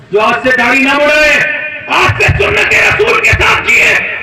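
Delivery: loud rousing call to the congregation; fiery preaching. Real, not acted. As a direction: whip up the crowd with forceful commands. Preacher rallies the crowd. (پرجوش بلند آواز میں خطاب)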